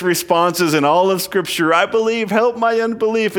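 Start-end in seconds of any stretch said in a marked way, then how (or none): 1.7-3.4 a touch of pleading, raised. Acted. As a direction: plead "I believe, help my unbelief" in earnest. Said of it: "This pleading with Jesus".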